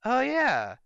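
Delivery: high, meek mock concession; a high mock admission from a caught-out fan; mock sheepish fan. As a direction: squeak out a grudging agreement. And they go.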